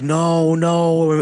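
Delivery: high, retold urgent objection; urgent protest. Group hushing the excited girl.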